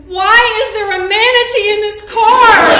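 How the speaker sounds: high, loud baffled cry; confused shock. Retold or imitated. Woman nose to nose with stranger in car, baffled shriek. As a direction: blurt it out in total bewilderment. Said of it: "my very confused mind said".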